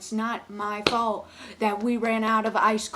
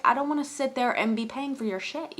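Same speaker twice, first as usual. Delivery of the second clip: usual speaking voice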